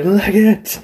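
high, giddy fleeing cry; excited escape. Pretend. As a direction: blurt it out while running off. Voicing the fleeing wrestler.

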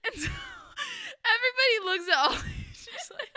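words breaking up in real laughter, pitched high; real giggles swamping the story; laughing. Real, not acted. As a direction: laugh through the line, barely getting it out. Laughing while retelling Holly's embarrassment.

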